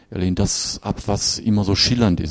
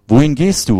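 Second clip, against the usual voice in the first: raised, higher storyteller's call; wife calling after her husband; calling after someone; 6 semitones higher, 6 dB louder than usual. A narrator's reading. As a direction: call it after him as he leaves. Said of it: "rief seine Frau ihm nach".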